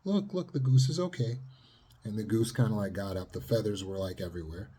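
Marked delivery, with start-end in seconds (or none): 0.0-1.4 high, coaxing comfort; soothing mother. Retold or imitated. Mother soothing her daughter after the goose, high and coaxing. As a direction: gently hush a scared child. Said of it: "the mother says".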